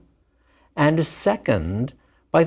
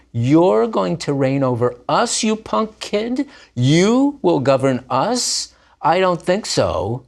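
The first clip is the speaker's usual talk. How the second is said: high, scornful jeering; mocking brothers; 7 semitones higher than usual. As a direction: sneer at the punk kid brother. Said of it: "Those infinitive absolutes add oomph".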